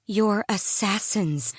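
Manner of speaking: grind it out at him; growled. A narrator's reading. Selena growls slowly at Sam in contempt. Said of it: "she growled at him".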